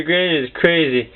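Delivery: ordinary talk, nothing marked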